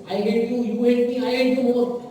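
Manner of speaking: loud mock family fight, shouting at each other; quarrelling family. A family at war every night, mock shouting. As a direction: hurl it like a bickering household. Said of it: "they are fighting like this every night".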